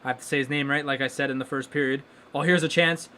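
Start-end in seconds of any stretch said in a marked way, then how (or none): none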